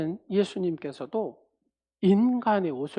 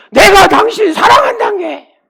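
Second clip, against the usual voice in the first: much higher, loud mock bark; harsh older husband; 13 semitones higher, 14 dB louder than usual. Older husband barking love at his wife, harsh. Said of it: "남자분들이 그러잖아요".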